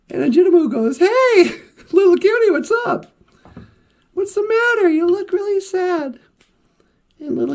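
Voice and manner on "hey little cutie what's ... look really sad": high, lively squirrel greeting; friendly squirrel. Acted. Squirrel greets sad little cutie climbing out, high friendly call. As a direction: call out higher, then ask what's wrong.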